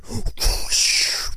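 long, voiceless rush of breath; imitated thunder noise. Telling co-host what they heard on the field, imitated thunder whoosh. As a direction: blow out a big whooshing rumble.

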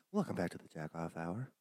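very low, soft show-host intro; put-on host voice; mock radio host. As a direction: drop deep for a fake late-night show welcome.

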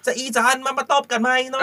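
high-pitched northern Thai caricature; mock northern Thai bickering. Host imitating northerners threatening a slap, high mock northern Thai accent. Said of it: "เวลาเขาด่ากันตีกัน".

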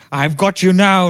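high, loud triumphant yell; gleeful threat. Retold or imitated. Teacher catching the kid, loud high gloat. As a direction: shout it as you catch him.

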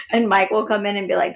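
ordinary talk, nothing marked

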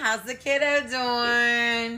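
high, slow, sweet teacher greeting; bright, slow and friendly with a parent. Shown as a demonstration. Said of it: "Here's my teacher voice".

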